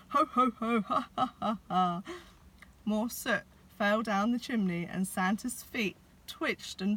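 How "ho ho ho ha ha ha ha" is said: loud ho-ho sliding into giggling ha-has; ticklish laughing. A narrator's reading. Santa tickled with a feather duster, loud laughing. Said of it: "bellowed Santa".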